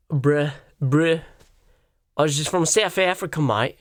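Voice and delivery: clumsy South African impression; exaggerated South African vowels; mock South African accent. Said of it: "South African accent".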